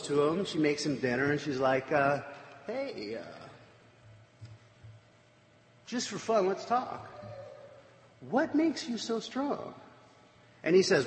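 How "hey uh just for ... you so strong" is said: high, soft, faux-casual coaxing; coaxing Delilah. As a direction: wheedle in a high, slowed voice, as if just for fun. Delilah coaxing Samson for his secret.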